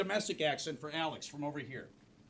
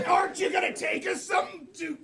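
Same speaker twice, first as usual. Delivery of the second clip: hammed-up kid's angry yell; 10 semitones higher, 4 dB louder than usual. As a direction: shout it high, acting outraged. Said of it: "the kids started yelling".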